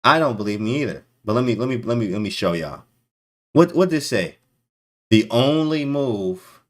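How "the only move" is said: dramatic slow reveal. Acted.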